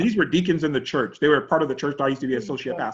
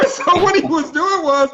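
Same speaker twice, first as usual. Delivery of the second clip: laughing; 12 semitones higher, 5 dB louder than usual. Real, not acted. Laughing while retelling.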